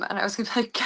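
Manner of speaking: usual speaking voice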